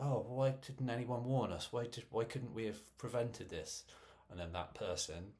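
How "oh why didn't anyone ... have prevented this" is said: higher, louder mock protest; dismayed complaint. People asking why nobody warned them, imitated dismay. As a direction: imitate people wailing in hindsight.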